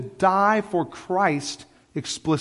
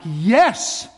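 raised, emphatic cry of assent; excited yes; 4 semitones higher, 5 dB louder than usual. Acted. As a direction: burst out with eager agreement. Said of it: "I feel it and I'm like".

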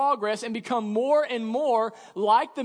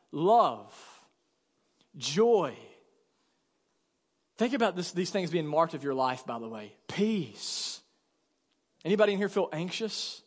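tender preaching; 3 semitones lower, 3 dB quieter than usual. Real, not acted. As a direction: lay each word down softly. Listing fruits of the Spirit.

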